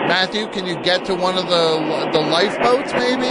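shouting over the chaos; loud, urgent plea. Acted. Calls out in flood chaos.

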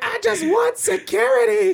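high, slow, whiny mock voice; mocking high whiny quote. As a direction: mimic her pleading in a high whine. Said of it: "You're like".

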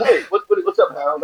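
a hint of a relaxed crew guy; casual producer. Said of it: "He goes".

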